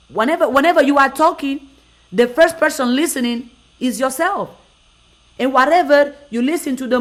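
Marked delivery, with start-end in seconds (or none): none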